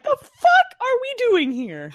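high, drawn-out mock disbelief; incredulous outcry. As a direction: stretch it out high, acting utterly baffled. Voices player's reaction to game.

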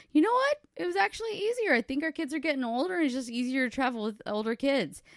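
high, airy mock-confident retelling; breezy self-quote. Telling brother-in-law the trip was easy, breezily high. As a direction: imitate your earlier carefree self, pitched well up.

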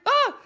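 a quick high startled cry; shocked yelp. Retold or imitated.

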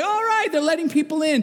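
high, eager burst of excitement; family thrilled the cones opened, excited. Retold or imitated. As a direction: cheer like the kids in the back seat. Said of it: "we got all excited".

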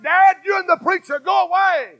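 high, strained cry of go away; a high, desperate plea to be left alone; daughter pleading. Retold or imitated. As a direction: daughter sending her father and the preacher away, high and pleading. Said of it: "she said".

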